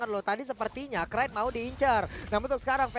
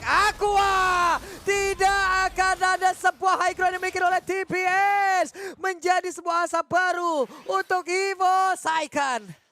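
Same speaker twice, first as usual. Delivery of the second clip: excited shouting; 7 semitones higher, 6 dB louder, slower than usual. Real, not acted. Caster hyping a team fight.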